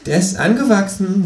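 high, sharp kangaroo voice; cheeky high kangaroo. A narrator's reading. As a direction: pitch the kangaroo up and sassy.

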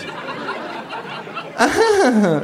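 hohes, nachgeäfftes Lachen; Känguru lacht mit. Acted. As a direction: das Fake-Lachen imitieren. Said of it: "lacht auch das Känguru". (nachgeäfftes Lachen)

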